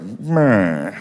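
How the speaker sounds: mock radio announcer's honk; vintage announcer noise; old-time announcer. Announcer reacting as Jackie comes to the plate, old-time announcer voice. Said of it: "can hear the announcers like".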